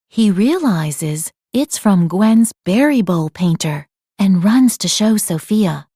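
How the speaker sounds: ordinary delivery, nothing marked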